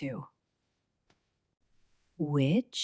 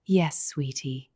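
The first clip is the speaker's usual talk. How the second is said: ordinary delivery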